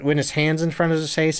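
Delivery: ordinary talk, nothing marked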